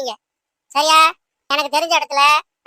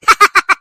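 villain laugh; 5 semitones higher, 7 dB louder than usual. Acted. Villainess laughs after threatening.

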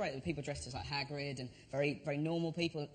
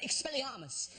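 mock spell cast with a laugh; kid casting a spell; 8 semitones higher, slower than usual. Little kid hexing the drunk spoiler man. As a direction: fire off the spell like a plucky kid. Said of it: "this little kid just went".

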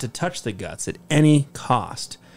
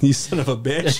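laughing. Real, not acted. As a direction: insult through laughter. Laughing insult.

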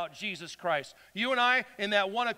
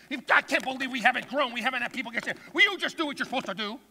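mock furious scolding, higher and louder; mean pastor rant; 5 semitones higher, 3 dB louder, faster than usual. Pastor berating his people over church growth, mock mean rant. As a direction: build to a rushed, exasperated tirade. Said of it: "I'm getting mean".

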